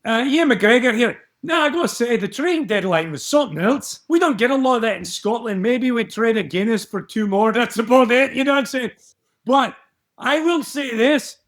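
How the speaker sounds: hammy Scottish pundit rambling about trophies; Ian McGregor. Pretend. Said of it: "his name is Ian McGregor".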